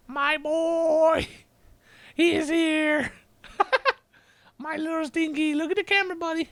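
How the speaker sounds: talking to his cat in a high cooing voice. Real, not acted. Baby talk to his cat.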